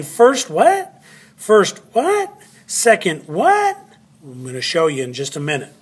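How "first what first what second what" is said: high, rising puzzled questions; puzzled student. Acted. Teacher voicing students asking what the labels mean, puzzled. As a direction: echo a confused class, pitch jumping on each what. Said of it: "And you're saying".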